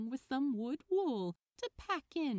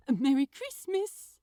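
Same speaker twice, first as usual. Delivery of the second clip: louder, cheery greeting; bright; 4 semitones higher, 9 dB louder than usual. A narrator's reading. Shopkeeper wishing his friend well, bright and cheery. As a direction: sing it out brightly. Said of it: "Aziraphale said brightly".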